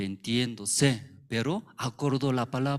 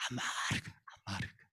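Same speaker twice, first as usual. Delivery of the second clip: whispered bitterness; 10 dB quieter than usual. Acted. Voicing bitterness.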